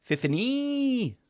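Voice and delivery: Fifini! drawn out very high and slow; sing-song the name Fifini way up high; playful high-pitched Fifini; silly high exclamation. Pretend. Sung-out silly high call.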